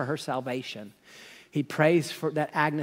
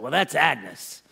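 slow, drawn-out "Well, that's Agnes."; slow Harry drawl; slower than usual. Retold or imitated. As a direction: drag "that's Agnes" out slowly. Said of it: "I don't know if Harry talked this way".

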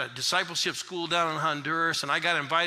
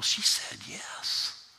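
whisper; 6 semitones lower, much slower than usual. Real, not acted. Awed reveal of conversion.

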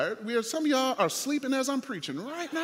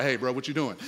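dude; 9 semitones lower than usual. Retold or imitated. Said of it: "a dude pop out like".

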